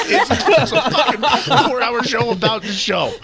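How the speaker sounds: barely getting it out for laughing; laughing through words. Real, not acted. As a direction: crack up while telling it. Cracking up while retelling his reaction.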